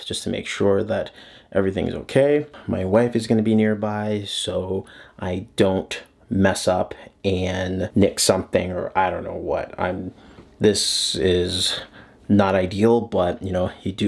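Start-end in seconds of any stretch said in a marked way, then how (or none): none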